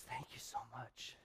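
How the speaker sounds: soft, slow thanks choked with tears; tearful thanks. Retold or imitated. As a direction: say it gently, as if crying. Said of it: "and she started to cry".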